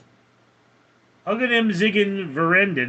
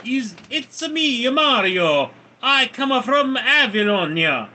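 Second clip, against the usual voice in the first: hammed-up Italian accent, high-pitched; big Italian-accent impersonation, high and loud; 6 semitones higher, 3 dB louder than usual. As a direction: play a cartoon Mario with an Italian accent. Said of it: "Italian accent are not too bad".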